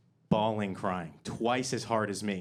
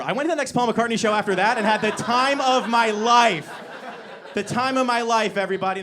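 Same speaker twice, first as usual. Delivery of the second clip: much higher and louder, laughing through it; mock joyful shout; 10 semitones higher, 9 dB louder than usual. Comic whooping the time of his life after mom's death. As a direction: whoop it up as if grief never happened.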